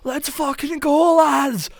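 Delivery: rally the lads into a charge; roaring war-cry of a redcoat-era fighter; rowdy Scottish soldier. Retold or imitated. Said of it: "he's like".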